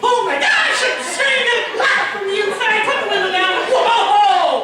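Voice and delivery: high, loud shriek of alarm; hammed-up snake panic; panicked yelling. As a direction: freak out at full volume. Acted panic at seeing a snake.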